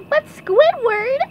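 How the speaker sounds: slow, loud, high cartoon protest; SpongeBob. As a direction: protest in a squeaky pipsqueak voice. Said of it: "said SpongeBob".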